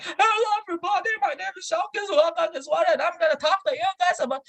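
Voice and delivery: squeaky high, rushed speech opening; too high voice. Shown as a demonstration. Speaker showing a bad contest opening, squeaky high and fast. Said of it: "Sometimes my voice is like".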